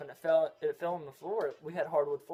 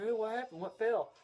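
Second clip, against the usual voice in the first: higher-pitched questioning grandma; a hint of grandma, pitched up; imitating grandma; 7 semitones higher than usual. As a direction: ask it as a lightly voiced, concerned grandma. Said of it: "she walked in there and said".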